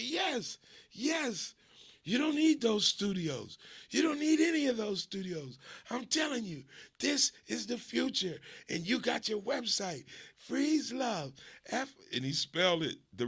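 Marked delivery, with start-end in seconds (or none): 0.0-11.9 much higher, louder preachy hustle; excited cousin. Retold or imitated. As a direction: hammer home that studios are dead. Cousin dismissing studios.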